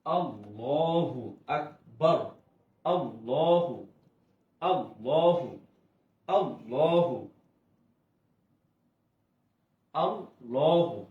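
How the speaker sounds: আল্লাহু recited with a thick, full lam, slow and loud; heavy lam recitation. Shown as a demonstration. Teacher drilling the class on a heavy lam, slow and loud. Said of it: "মোটা করে পড়তে হবে".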